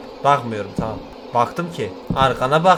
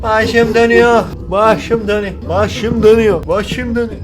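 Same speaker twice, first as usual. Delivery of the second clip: mock dizzy; 6 semitones higher, 4 dB louder than usual. Character dizziness in game.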